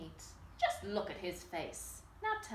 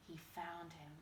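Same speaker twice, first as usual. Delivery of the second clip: ordinary delivery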